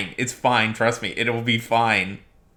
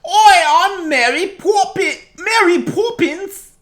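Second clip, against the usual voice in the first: hammed-up British accent, much higher and slow; mock a bad actor's British accent, pitched way up; fake British accent; 9 dB louder, slower than usual. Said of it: "putting on a real fake".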